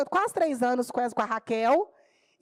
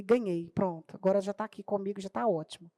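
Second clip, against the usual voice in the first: softer, satisfied murmur of victory; quiet triumph; 5 semitones lower, 5 dB quieter than usual. Retold or imitated. Teacher winning the boy over, quietly pleased. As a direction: say it low to yourself, pleased.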